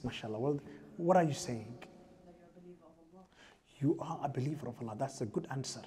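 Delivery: ordinary delivery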